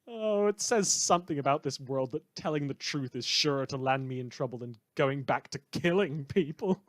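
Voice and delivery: smug, theatrical broadcaster sneering at his listeners; villainous radio host. Acted. A rogue radio host taunts his listeners about killing, in a smug on-air voice.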